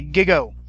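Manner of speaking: "gigo" said loud and crisp; catchphrase punched. Shown as a demonstration. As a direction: drill the motto "gigo" into them. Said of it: "that stands for garbage in".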